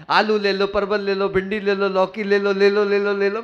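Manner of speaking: rapid-rolling monotone street-vendor chant; imitated sabziwala's repetitive cry; vegetable hawker's call. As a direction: reel off the vegetables like a cart seller. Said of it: "ये तो क्या style है बोलने की".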